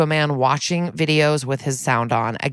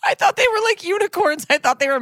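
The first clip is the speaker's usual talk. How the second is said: far higher, laughing squeal; squeaky laughing; faster than usual. Real, not acted. She admits her mistake, squeaking far higher. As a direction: squeak it out mid-laugh at yourself.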